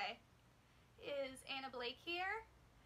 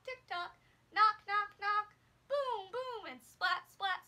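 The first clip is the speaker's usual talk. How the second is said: loud animal and noise sounds; sound effects; 3 semitones higher, 7 dB louder than usual. Shown as a demonstration. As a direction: make the noises with the kids. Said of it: "do this with me".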